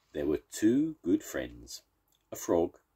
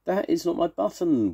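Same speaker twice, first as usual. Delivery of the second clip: storybook wail; 13 semitones higher, 3 dB louder than usual. A narrator's reading. Toad rejecting the raccoon's button, wailing high. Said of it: "wailed Toad".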